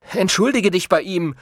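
high, bossy order; commanding. A narrator's reading. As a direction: order the dimwit about. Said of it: "befahl der Kleine dem Idioten".

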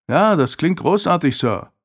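mock enthusiasm with a high start, falling off on Sir; sarcastic retort. A narrator's reading. Nephew answering his uncle's demand for responsibility, sarcastic. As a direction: counter with false admiration. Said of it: "konterte Isaac".

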